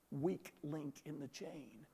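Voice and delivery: slow, hushed and low; ominous realization. Retold or imitated. Professor realizing all the crew are watching him, hushed dread. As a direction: let each word sink in softly. Dread phrase appears in his mind.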